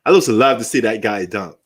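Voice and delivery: normal talking voice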